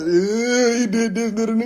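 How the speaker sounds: high, wailing fake sob; whiny crybaby tattling, pitched high; mock crying. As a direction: imitate a brother bawling out his accusation. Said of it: "just activated his crydom".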